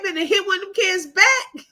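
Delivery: high-pitched talk breaking into laughter; laughing. Real, not acted. Joking about people getting hit at school, laughing. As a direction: crack up as you say it. Cracking up about hitting back.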